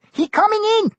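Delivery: very high panicked pidgin cry; alarmed shout. A narrator's reading. Kimmy warning Tuck of the shark, very high panicked cry. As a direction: cry the warning in broken English.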